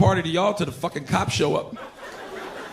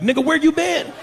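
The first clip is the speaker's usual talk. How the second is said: excited greeting; 8 semitones higher than usual. Retold or imitated.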